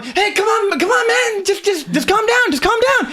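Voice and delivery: very high, urgent pleading; another guard's voice. Acted. Second guard to yelling guard, high urgent calming. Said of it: "you hear another voice going".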